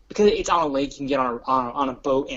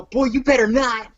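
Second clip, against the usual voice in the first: higher mock-scolding warning; mock threat; 6 semitones higher, 3 dB louder than usual. Dad pretends to slap his kid, mock threat. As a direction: scold in playful pretend outrage. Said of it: "he pretends to slap me".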